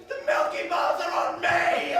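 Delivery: slow, high, proud shout; triumphant shout. Retold or imitated. Little guy standing over the fighter, shouting proudly. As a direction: boom it out like a movie hero. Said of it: "he just goes".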